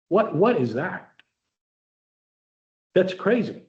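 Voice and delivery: ordinary delivery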